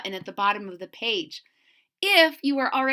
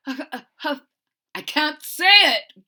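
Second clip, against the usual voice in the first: slow, louder giggly line; giggling; 3 semitones higher, 6 dB louder, much slower than usual. Acted. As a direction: giggle the words out. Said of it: "giggled the rhinoceros".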